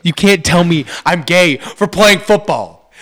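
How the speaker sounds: usual speaking voice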